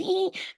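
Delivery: soft, very high titter; tiny impish giggle in character; munchkin giggle. Retold or imitated. Shroom munchkins finishing their tease, high little giggle. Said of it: "They were like shroom munchkins".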